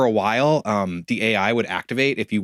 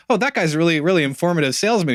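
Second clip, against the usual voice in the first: higher, louder clueless remark; naive quote; 7 semitones higher, 4 dB louder than usual. Retold or imitated. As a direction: sound innocently impressed. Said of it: "I was just like".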